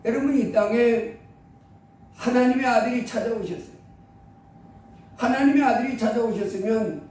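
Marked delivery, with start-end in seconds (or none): none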